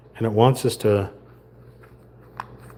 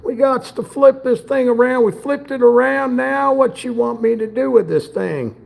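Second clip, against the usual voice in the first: high, playful cartoon voice; goofy character voice; 9 semitones higher than usual. Talking to the furniture piece in a silly high voice. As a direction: keep the silly voice going while fumbling.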